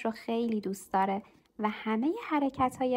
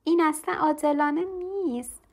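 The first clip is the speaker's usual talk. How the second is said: put-on child's shout, higher and louder; loud protest; 5 semitones higher, 5 dB louder than usual. A narrator's reading. Lucy shouts at her mother about the changed class. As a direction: belt out the unfairness. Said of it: "لوسی با صدای بلند گفت".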